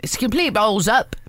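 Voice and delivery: broad Australian twang on it; Australian accent, repeating the committee member. Retold or imitated. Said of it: "he said it in an Australian accent".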